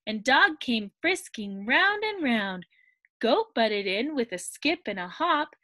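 usual speaking voice

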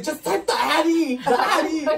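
loud, high, drawn-out mock demand; exasperated imitation. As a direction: insist slowly, voice way up. Acts out friend's exasperated demand.

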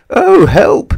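high, loud cry for help; panicked shriek. A narrator's reading. Mister Jelly at the washed-away bridge, panicked shriek. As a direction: shriek it in a fright. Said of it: "shrieked Mister Jelly".

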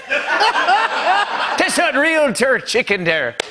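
high, drawn-out shout in fake Jamaican; hammed-up Jamaican-accented yell; mock Jamaican accent. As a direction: holler it slowly in a bad Jamaican accent. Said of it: "sounds more Jamaican to me".

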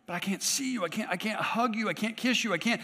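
mock plaintive protest. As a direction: play a believer protesting to Jesus. Acts out a believer's protest.

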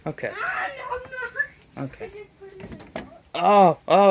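normal talking voice

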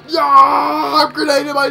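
loud, high yell; shouted in a gaming frenzy; excited battle yell. Real, not acted. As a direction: holler it mid-firefight. Frantic attack during game.

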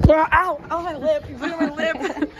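high yelps breaking into laughter; pained yelp. Real, not acted. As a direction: yelp in surprise at the sting. Gets something on a lip.

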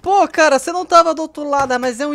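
loud, fast frustrated outburst; angry yell. Real, not acted. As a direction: yell at the idiot teammate. Raging at a dumb teammate.

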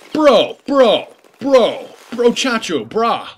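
loud, high, put-on bro calling out; mock bro voice. Gamer mocking a viewer's correction in a high, loud bro voice. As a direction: ham up a pushy bro.

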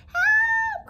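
very high, loud squeal for help; hammed-up high-pitched yelp; panicked cry. A narrator's reading. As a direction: shriek for help like a frightened cat. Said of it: "cried the cats".

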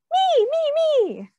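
very high, eager squeal; eager kid. Acted. Hungry customer begging the pizza carrier, high eager squeal. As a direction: pick me, pick me, bouncing with want. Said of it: "this person's saying".

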